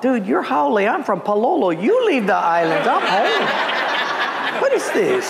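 high, hammed-up comeback; mock indignation. Local preacher told to leave the island, mock indignant. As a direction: fire back in comic outrage. Indignant retort to critical pastor.

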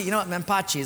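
ordinary delivery

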